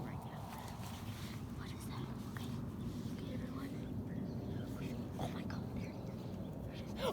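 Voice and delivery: hushed sneaking whisper. Pretend. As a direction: whisper so the beast won't hear. Sneaking up on the creature.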